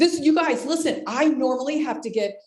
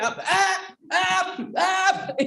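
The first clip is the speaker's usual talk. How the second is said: mock pained hand-raising; 6 semitones higher, much slower than usual.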